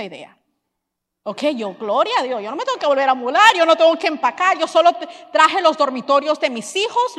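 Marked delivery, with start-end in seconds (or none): 1.7-7.2 loud, high, rushing joy; excited. Retold or imitated. Wife telling the congregation how happy she was, loud and excited. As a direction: tumble out the words in delight.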